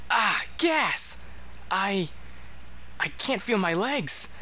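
sudden high alarmed cry; startled yelp. Real, not acted. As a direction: yelp it out in surprise. Poisoned by trap chest gas.

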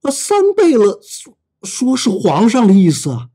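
halting stammer, jumping up in pitch. Acted. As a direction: stumble over the words in fright. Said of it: "结结巴巴地说".

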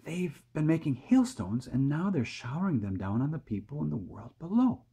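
hushed excitement, pitched high; high-voiced eager explaining, held back; excited whisper. A narrator's reading. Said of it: "whispered James excitedly".

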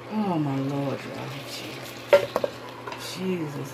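normal talking voice

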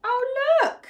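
high, bright gasp of discovery; wide-eyed surprise for the child; surprised oh look. Acted. Reader showing the child the egg, surprised.

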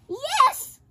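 cried out. Acted. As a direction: shriek yes with delight. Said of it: "cried the witch".

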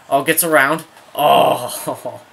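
loud cry as the chance slips by; excited near-miss reaction. Real, not acted. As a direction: burst out over a close chance. Near goal in live play.

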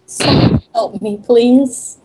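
ordinary delivery

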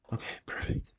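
breathy whispered okay. Real, not acted. Gamer whispering to themself while crafting, whispered. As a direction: whisper it to yourself.

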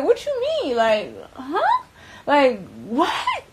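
high, rising incredulous questions; shocked disbelief. Retold or imitated. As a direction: retell it like you can't believe your ears. Retold shocked reply to him.

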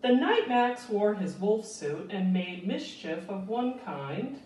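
gentle, lilting storybook read-aloud; reading to children. A narrator's reading. Teacher reads Where the Wild Things Are to children, lilting storybook voice. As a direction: read it like bedtime story time for little ones.

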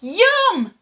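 put-on very high, loud children's "yum". A narrator's reading. Children turning on the troll, high loud relish. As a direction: squeal it high as gleeful storybook children. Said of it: "say the children".

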